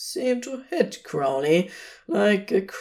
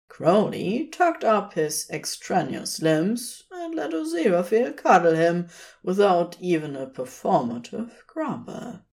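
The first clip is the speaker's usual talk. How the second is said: plain reading voice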